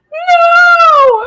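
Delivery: high, loud wailing no; anguished cry. Retold or imitated. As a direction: cry out as if losing a friend. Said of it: "I was like".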